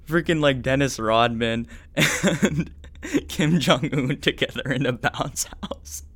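real laughter squeezing through the words; struggling through laughter. Real, not acted. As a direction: try to get it out while cracking up. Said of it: "trying so hard not to laugh".

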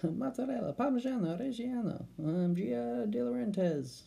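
high, showy Italian cheese list; playful sing-song. Real, not acted. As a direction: roll the names out with flair. Rattling off cheese names playfully.

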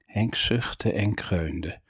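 plain reading voice

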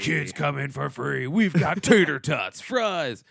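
slow announcer read; drawn-out hyped promo; monster truck ad. Pretend. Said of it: "It's always like".

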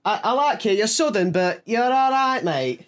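tipsy Mancunian impression; Mancunian accent. Drunk Mancunian befriending a Southerner, imitated Mancunian accent. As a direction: imitate a drunk Manc being grudgingly friendly. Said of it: "going Mancunian again".

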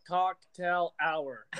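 very slow and careful, each syllable spelled out; deliberate slow enunciation; slowly sounded out. Retold or imitated. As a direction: sound out Cocktail Hour like a nervous speaker. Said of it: "I literally sounded it out".

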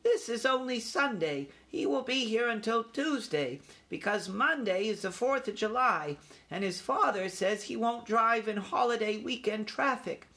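high-pitched, assured youngster; young mouse taking charge; young mouse leader. A narrator's reading. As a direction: lay out the plan in a small, high voice. Young mouse answers his uncle.

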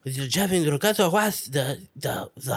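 half-hearted Castilian Spanish impression, faltering at the end; badly faked Castilian accent, losing confidence; mock Castilian accent. Second try at imitating his sister, mock Castilian accent. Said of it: "the Castilian accent".

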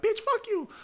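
very high-pitched imitation of sparring girls; squeaky mock threats, not shouted; mock girl fight. Two girls facing off before a fight, mock threatening.

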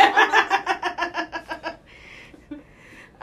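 high, loud burst of laughter. Real, not acted. Laughing at puberty joke.

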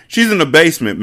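loud, high shout; yelled. A narrator's reading. Mecca yelling at Mati before firing. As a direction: yell it before the shot. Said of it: "Mecca yelled".

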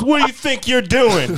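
high-pitched imitation of an indignant mother; mock scolding mom. Friend's mom to boy at the hose, mock scolding. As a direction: act out a mom demanding answers.

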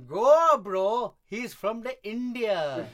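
high, loud mock Indian accent; Indian accent impression. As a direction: imitate the Indian teacher's accent. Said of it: "You wanna know what it sounded like".

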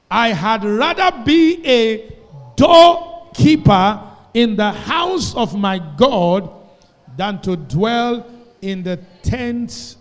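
loud, slow, ringing scripture reading; big pulpit voice, drawn out and loud; preaching at full force. Acted. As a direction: proclaim every word to the whole church, slowly.

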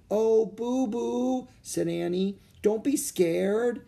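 high, slow, soothing coax; comforting. Acted. Annie soothing scared Boo Boo, slow high gentle voice. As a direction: soothe a frightened child.

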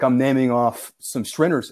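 ordinary delivery

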